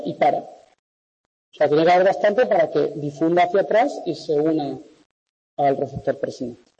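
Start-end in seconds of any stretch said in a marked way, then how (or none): none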